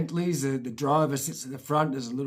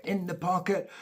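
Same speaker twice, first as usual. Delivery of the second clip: usual speaking voice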